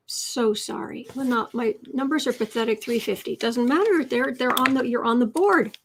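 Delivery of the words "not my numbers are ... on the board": ordinary talk, nothing marked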